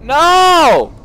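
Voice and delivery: high, very loud cry; big high-pitched shout; alarmed scream. Real, not acted. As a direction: yell out in alarm. Cries out at game mishap.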